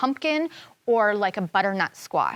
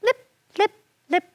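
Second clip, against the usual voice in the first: high light "lip, lip, lip" like tiny dancing feet; dancing sound; 11 semitones higher than usual. Acted. Narrator sounding the fairies' dance, light and high. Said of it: "They danced around it".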